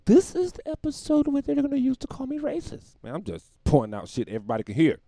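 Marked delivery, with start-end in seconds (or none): 0.0-2.9 very high, louder comic voice; squeaky aside. Pretend. Comic high-pitched aside about backlash.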